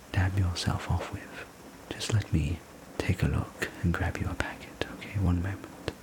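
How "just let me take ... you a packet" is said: ordinary delivery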